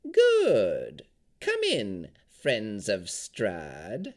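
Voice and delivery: slow, drawn-out crone voice; a sly old hag luring them in. A narrator's reading.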